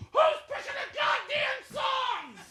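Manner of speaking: thin, despairing cry of protest; hammed-up breakdown. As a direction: squeal it out as the tears take over. DJ breaks down over sad song.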